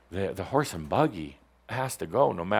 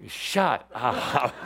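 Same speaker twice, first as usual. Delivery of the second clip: ordinary talk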